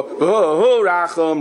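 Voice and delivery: V'hu Rachum drawn out very slowly, each syllable held long; slow, lengthened chant of V'hu Rachum; stretched out slowly. Shown as a demonstration. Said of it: "You stretch it out".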